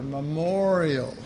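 memorial said very slowly with a falling close; memorial stressed. Shown as a demonstration. Pastor urging the congregation to remember, slow emphasis.

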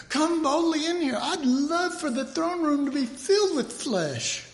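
high, hammed-up cheery invitation; mock inviting God. God imagined inviting the flesh in, high mock cheer. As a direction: sing out the welcome in a bright high mock voice. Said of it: "and saying".

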